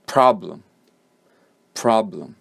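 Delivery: "problem" as PROB-lem, strong start, weak falling end. Shown as a demonstration. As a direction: punch "prob", barely try on the "lem" of "problem". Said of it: "the second syllable is quieter".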